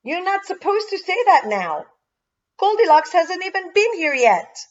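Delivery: put-on high-pitched scolding child; bossy correction. A narrator's reading. Tara telling Albert he's jumped ahead, bossy.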